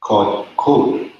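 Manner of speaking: called code said slowly; pronunciation demo. Shown as a demonstration. Teacher slowly models called code for students. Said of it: "This is pronounced".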